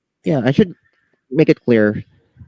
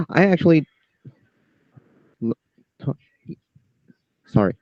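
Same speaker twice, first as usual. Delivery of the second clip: stammering; 3 semitones higher than usual. Real, not acted. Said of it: "I'm just stammering".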